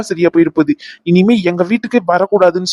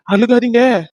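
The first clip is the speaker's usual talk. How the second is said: higher, more insistent urging; insistent plea; 4 semitones higher, slower than usual. Acted. Husband urging his wife not to cry, insistent. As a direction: press the plea in a raised pitch.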